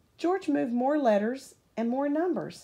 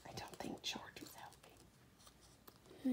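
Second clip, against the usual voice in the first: slow unvoiced whisper; 19 dB quieter, slower than usual. Real, not acted. Reader confides to the listening child, whispering. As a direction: lean in and whisper the doubt. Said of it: "reader aside to child".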